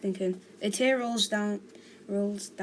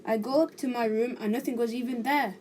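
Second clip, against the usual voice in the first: ordinary delivery, nothing marked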